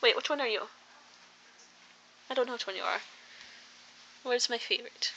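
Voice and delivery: ordinary talk, nothing marked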